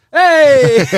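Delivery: loud, high hype shout. Retold or imitated. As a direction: yell it across the room. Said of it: "somebody would just shout it out".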